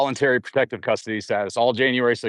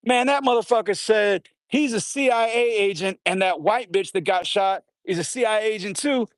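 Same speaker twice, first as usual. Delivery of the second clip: higher, heated jailhouse gossip; indignant inmate rant; 8 semitones higher than usual. Retold or imitated. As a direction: spit out the accusation. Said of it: "And they said".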